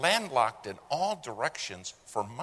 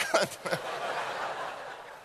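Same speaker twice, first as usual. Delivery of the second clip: silent airy laugh; wheezing real laugh; breathless wheeze laugh; 7 semitones higher than usual. Real, not acted. Laughs at own punchline.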